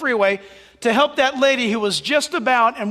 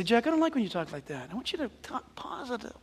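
mock complaining listener; 12 dB quieter, faster than usual. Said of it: "You say".

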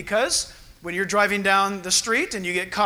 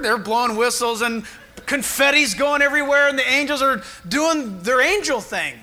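normal talking voice

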